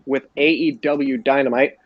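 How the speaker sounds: ordinary delivery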